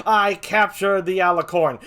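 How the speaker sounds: mock boast, slow and loud; hammed-up triumphant announcement; acting big. Small-time host claiming a capture to his world, mock boast. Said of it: "I tried to act bigger than I really was".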